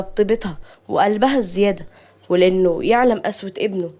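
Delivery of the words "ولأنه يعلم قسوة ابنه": usual speaking voice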